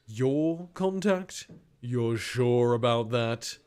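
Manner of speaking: slow, doubting interrogator. Acted. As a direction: stretch out the question in the man's voice. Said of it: "A man's voice, deep, resonant".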